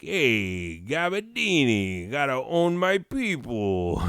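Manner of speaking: high-pitched cartoon caricature; mock slave owner.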